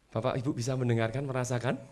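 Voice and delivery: ordinary talk, nothing marked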